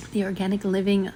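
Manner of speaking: normal talking voice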